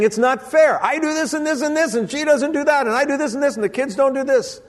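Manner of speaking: whiny, high mock husband griping; complaining husband. Pastor mimics a bitter husband, high whiny complaint. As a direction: mimic a sulky husband's grievance. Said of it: "he starts saying".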